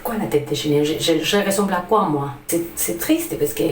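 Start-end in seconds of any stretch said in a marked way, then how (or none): none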